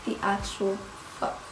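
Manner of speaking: low, hushed, word-by-word disbelief; stunned shock. Retold or imitated. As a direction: leave gaps between words, as if frozen. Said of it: "my heart, like, stopped".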